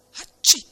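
loud, high storybook sneeze; sudden explosive mock "atchoo"; acted sneeze. A narrator's reading.

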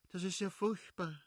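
viel höher, bestürzt; seufzend bestürzt. A narrator's reading. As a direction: entsetzt aufseufzen. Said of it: "seufzte tief". (Stepan bestürzt über die Scheidung, klagend)

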